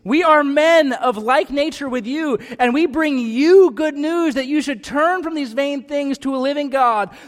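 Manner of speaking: loud, high, slow plea; crying out. A narrator's reading. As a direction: call out over the crowd. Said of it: "crying out".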